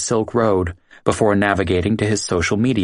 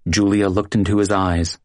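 plain reading voice

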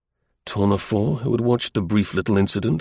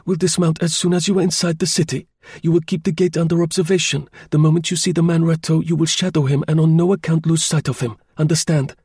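high voice that admits no argument; sharp, decisive; 7 semitones higher than usual. A narrator's reading. Chauvelin gives Tournefort his gate orders, sharp and decisive. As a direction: give firm orders, pitched well up. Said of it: "sharp, decisive tones which admitted of no argument".

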